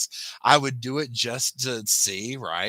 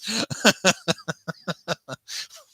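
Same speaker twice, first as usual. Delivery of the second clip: giggling laugh; 8 semitones higher, 4 dB louder than usual. Real, not acted. Keeps laughing.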